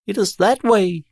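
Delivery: high, loud cooing pigeon voice. Acted. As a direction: speak like a chirpy pigeon. Pigeons point the way.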